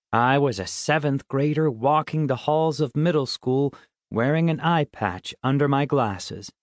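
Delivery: ordinary delivery, nothing marked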